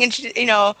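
normal talking voice

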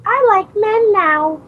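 put-on high, slow childlike voice; dopey kid saying it slowly; Ralph Wiggum. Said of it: "Ralph's like".

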